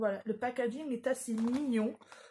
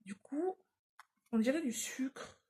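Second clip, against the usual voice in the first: whisper; 2 semitones higher, 4 dB quieter than usual. Real, not acted. Sniffing candle, musing aside.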